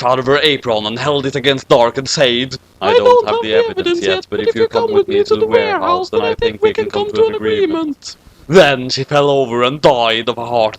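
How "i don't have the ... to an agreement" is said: much higher, squeaky mom voice; high falsetto threat. Acted. Mom threatening Dark with a knife, in a high squeak.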